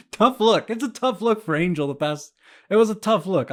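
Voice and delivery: laughing it off, loud and high. Real, not acted. To listeners, laughing at his own awkward exit. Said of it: "laughing at himself".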